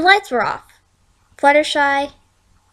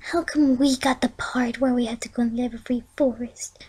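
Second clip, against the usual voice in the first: whispered; 7 dB quieter than usual. A narrator's reading. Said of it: "Fluttershy whispered".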